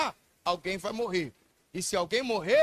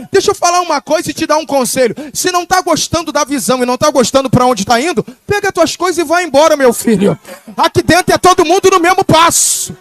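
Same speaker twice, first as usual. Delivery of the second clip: loud, rising sermon climb to a shout; preaching at full force; 8 semitones higher, 13 dB louder than usual. Real, not acted. Preacher to the congregation, shouting at full force. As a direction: drive it home, louder with each line.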